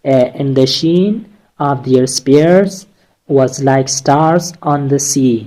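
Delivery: very slow, beat on "sheen", "spears", "stars", "sea"; scanned slowly. Shown as a demonstration. Teacher reads the anapestic example to her class, slow and scanned. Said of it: "यहाँ पे एक example इस एनापेस्टिक का दिया गया है".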